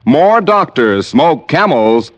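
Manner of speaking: ordinary talk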